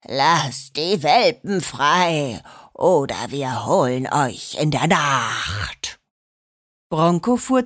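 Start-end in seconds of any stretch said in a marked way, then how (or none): 0.0-6.0 low, slow, booming cellar ghost; deep ghost voice. Acted. Schorsch spooks the dognappers over the walkie-talkie, low slow booming ghost. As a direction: drop the voice as deep as it goes and drone slowly. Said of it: "dröhnte es plötzlich aus dem Lautsprecher".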